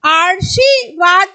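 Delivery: आशीर्वाद slowed, र shifted toward शी; teacher showing the incorrect reading; wrong placement demo. Shown as a demonstration. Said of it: "इसका उच्चारण स्थान यहाँ पे आ जाएगा".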